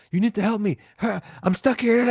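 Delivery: high, frantic cry for help; freaking out. Retold or imitated. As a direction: play her freaking out and begging. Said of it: "she freaks out and goes".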